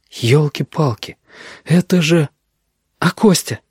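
put-on high, dazed young man; amazed, high-voiced student; stunned disbelief. A narrator's reading. Denis stunned at passing exam.

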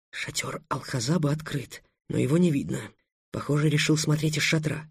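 soft hushed aside; whisper. Acted. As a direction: whisper it backstage. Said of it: "прошептал". (шёпот)

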